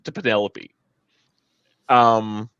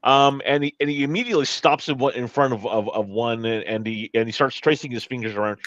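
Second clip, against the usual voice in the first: normal talking voice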